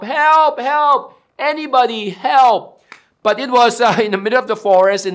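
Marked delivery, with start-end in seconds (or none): 0.0-2.8 higher, louder cries into the dark; acted call for help. Man hanging from a branch calls for help, acted desperate cry. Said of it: "started to call for help".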